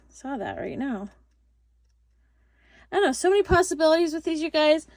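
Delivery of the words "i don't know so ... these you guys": higher, louder and fast, bubbling over; excited gush. Real, not acted. Crafter gushing to viewers about the possibilities, excited. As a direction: gush with delight at the idea.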